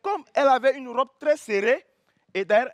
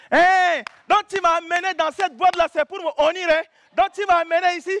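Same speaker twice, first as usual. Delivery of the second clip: indignant accusation; 5 semitones higher than usual. Retold or imitated. Girlfriend accusing him of shaming her, raised mock outrage. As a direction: accuse him like a wronged woman.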